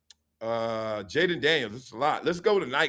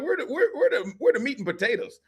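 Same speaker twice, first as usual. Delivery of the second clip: high laughing question; laughing demand; 9 semitones higher than usual. Retold or imitated. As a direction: ask for the real stuff through a laugh. Quoted reaction while laughing.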